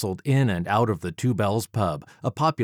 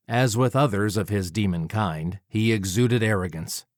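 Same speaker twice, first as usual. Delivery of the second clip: usual speaking voice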